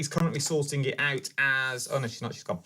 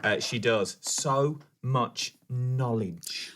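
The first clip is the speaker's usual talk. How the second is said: slow, lower-pitched reading aloud; message read-out; 5 semitones lower, much slower than usual. Acted. Co-host reading Tracy's praise of the crafter, slow read-out. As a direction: read out praise with drawn-out emphasis.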